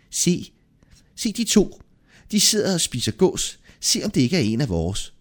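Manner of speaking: high-pitched woman's character voice; high voice of a suspicious wife; innkeeper's wife. A narrator's reading. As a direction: point them out to your husband, pitched high. Innkeeper's wife speaking to her husband.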